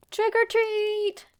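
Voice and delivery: high, loud, slow excited shout; excited kids' scream. A narrator's reading. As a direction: scream it with the kids' delight at the open door. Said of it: "they both screamed with excitement".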